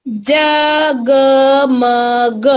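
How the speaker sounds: जगमग stretched slowly, syllable by syllable; slow drawn-out reading. Shown as a demonstration. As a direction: drag out जगमग for the class. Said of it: "जोर से बोलेंगे". (जगमग धीरे खींचकर)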